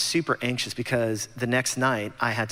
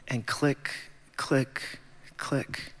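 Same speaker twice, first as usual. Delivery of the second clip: each click softer, fading to a whisper; hushed clicks; 5 dB quieter, much slower than usual. Acted. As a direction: dread in the clicks. Dramatic pause over clicking email.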